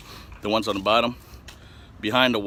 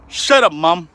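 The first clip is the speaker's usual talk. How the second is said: "Shut up, Mom" yelled higher and louder; loud retort; 8 semitones higher, 10 dB louder, slower than usual. Retold or imitated. Son yelling back at his mom, louder and higher. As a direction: bark "Shut up, Mom" up high.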